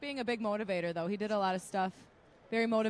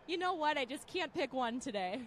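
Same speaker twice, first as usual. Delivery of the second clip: put-on playful Minnesota accent, pitched up; 5 semitones higher than usual. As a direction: lay on a sweet Minnesota accent. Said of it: "Gotta pull out the Minnesota accent".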